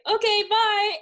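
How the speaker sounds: high, loud, oblivious sign-off; mock breezy goodbye. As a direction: chirp it loudly and be gone. Acts prince leaving abruptly, obliviously.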